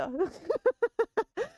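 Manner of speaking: a short laugh that breaks out. Real, not acted. Laughing after the punchline. (risa breve)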